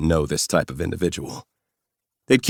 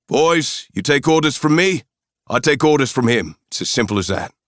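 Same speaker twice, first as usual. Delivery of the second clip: put-on South African accent, raised high and loud to the men; calling to his men; 9 semitones higher, 5 dB louder than usual. Mercenary leader orders his men to obey the spy, loud South African accent. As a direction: call out to the boys behind you in a South African accent.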